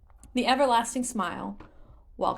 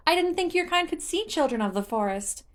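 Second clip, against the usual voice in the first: high, lightly surprised sprite voice; a small forest child, puzzled; childish Korok; 10 semitones higher than usual. Acted. Korok telling Link about forest children, high childish voice.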